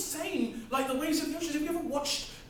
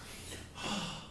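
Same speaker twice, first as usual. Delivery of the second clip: long, soft unvoiced sigh; worn-out sigh before begging for water; weary sigh; 11 dB quieter than usual. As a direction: let out a tired, breathy sigh. Distraught man sighs.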